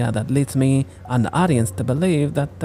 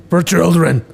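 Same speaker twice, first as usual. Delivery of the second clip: loud; 4 semitones higher, 6 dB louder than usual. Acted. Kid protests.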